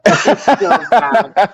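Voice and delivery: loud, slow laughing cry; bursting out laughing. Real, not acted. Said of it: "Oh, my God".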